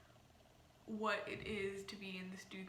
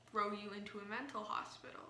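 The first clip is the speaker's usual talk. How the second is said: ordinary talk, nothing marked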